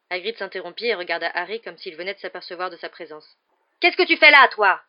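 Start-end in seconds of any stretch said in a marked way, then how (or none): 3.8-4.8 sudden roar. Acted. Hagrid suddenly roars at Harry, a loud bellow. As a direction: roar it out, much higher and much louder. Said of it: "rugit-il si brusquement".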